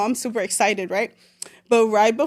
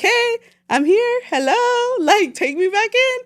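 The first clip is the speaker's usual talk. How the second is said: much higher and slow, a sing-song "Hey, I'm here"; breezy mock greeting; 10 semitones higher, slower than usual. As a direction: act like nothing happened, all innocent. Imagined arrival at parents' house.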